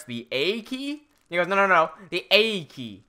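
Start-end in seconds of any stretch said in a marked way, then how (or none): 0.0-1.1 retold puzzled question, rising on "A key". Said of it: "I'm like". 1.5-3.0 put-on slow Australian-accented correction, falling on "A key". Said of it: "That's why I struggle with this accent".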